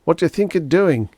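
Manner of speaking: high, loud indignant demand; outraged cry. A narrator's reading. Groversb at the people attacking his piano, outraged. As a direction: hurl the question in outrage.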